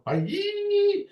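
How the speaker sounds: a sudden high squeaky "yee!" call; mock umpire yelp way up high; high falsetto ball call. Mimics his ball call.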